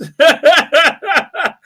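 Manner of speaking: high, loud burst of laughter. Real, not acted. Streamer amused by his own elevator tale, laughing hard. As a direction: crack up at your own story.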